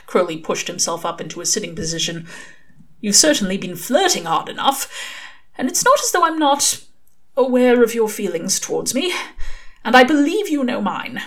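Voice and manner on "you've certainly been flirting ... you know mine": Aziraphale, earnest angel. Acted. Angel tells Crowley it is a date, higher and earnest. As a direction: plead your case with rising feeling.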